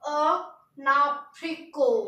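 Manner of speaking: अनाप्रिको drawn out slowly, syllable by syllable; slow French pronunciation. Shown as a demonstration. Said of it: "कैसे पढ़ेंगे?".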